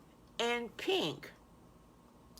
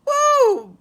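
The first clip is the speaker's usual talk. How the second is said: loud high whoop of joy; wild excited woo; cheer; 16 dB louder than usual. Acted. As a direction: whoop it out like a kid. Boys cheer over half price.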